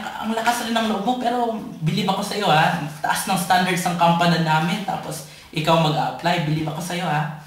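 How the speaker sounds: ordinary talk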